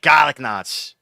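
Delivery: Boston accent, garlic said as kalik with no r. Shown as a demonstration. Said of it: "we'll say garlic knots like this".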